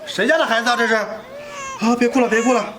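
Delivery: loud, high, flustered exclaiming. Acted. Man hushes a foundling and looks for its parents, flustered. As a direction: make yourself heard over the baby's wailing. Looks round, hushing crying baby.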